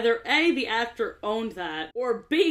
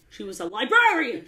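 LIBRARIAN leaned on and louder; mock emphasis; 5 dB louder than usual. Mocking the actor's odd stress, leaning on LIBRARIAN. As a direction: overstress "librarian" like the bad actor. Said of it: "The words he chooses to emphasize".

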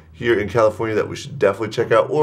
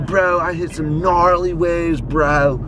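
put-on Laguna Beach surfer accent; 5 semitones higher, much slower than usual. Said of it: "We'll do, like, the Laguna Beach accent".

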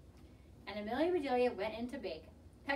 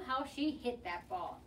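ordinary delivery, nothing marked

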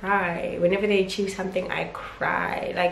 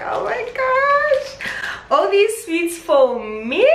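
high, loud, slow delighted gush; thrilled she can't contain it; opening the family gift, overjoyed and louder; 11 semitones higher, 5 dB louder, slower than usual. Real, not acted.

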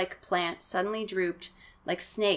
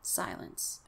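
whispered, barely-there single word; very soft suspenseful hush; 12 semitones lower, 12 dB quieter than usual. A narrator's reading. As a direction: let it vanish into a whisper. Line repeated hushed for suspense.

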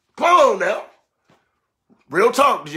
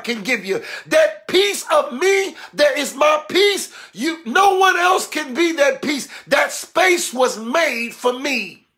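driving, insistent sermon cadence; full-force preaching; 5 semitones higher than usual. Real, not acted. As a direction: hammer the message home in rhythmic repeats.

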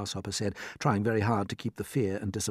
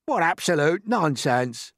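loud, slow, pitched-up contempt; scornful cry; 8 semitones higher, 6 dB louder, slower than usual. A narrator's reading. Earthworm rejects grasshopper's comfort, crying out. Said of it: "cried the earthworm".

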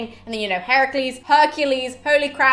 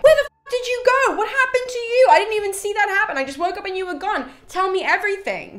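high, loud frantic questions; voiced Aeneas, frantic and much higher; shocked Aeneas; 11 semitones higher, 4 dB louder than usual. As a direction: demand answers from a lost friend. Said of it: "where Aeneas is like".

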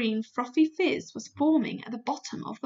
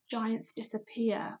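hushed secret whisper; whispered aside; 6 dB quieter, slower than usual. Real, not acted. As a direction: breathe it like a secret. Whispered secret plan in recap.

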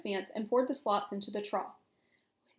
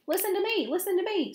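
high, insistent goose cry; frantic goose, mildly screamed; 9 semitones higher, 4 dB louder than usual. A narrator's reading. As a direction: demand attention in a high raised voice. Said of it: "screamed the goose".

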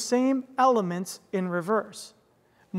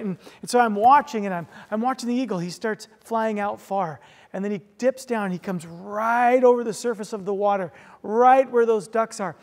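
usual speaking voice

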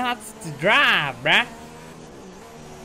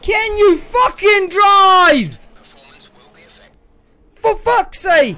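much higher, louder, slow raging yell; furious shout; 8 semitones higher, 10 dB louder, much slower than usual. Real, not acted. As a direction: scream at the driver ahead. Shouting at rival driver.

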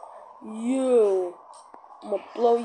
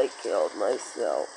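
deep voice. Acted. Character dies.

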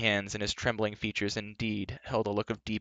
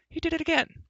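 excited. Acted. Fluter excited.

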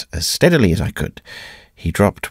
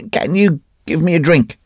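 whimpered plea; 9 semitones higher than usual. A narrator's reading. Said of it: "he whimpered".

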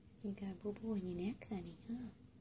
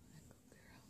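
breathy whispered praise; 20 dB quieter than usual. Real, not acted. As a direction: whisper it to the pet. Repeated pet praise.

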